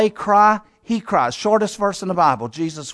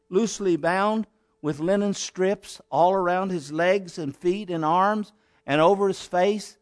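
ordinary talk, nothing marked

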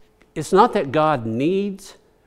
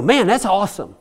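put-on kids' slang; 4 semitones higher, faster than usual. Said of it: "they'd go".

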